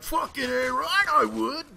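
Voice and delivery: high, loud, slow bro cheer; eager dude. Retold or imitated. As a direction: ham up an eager guy shouting yes. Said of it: "there's some dude out there be like".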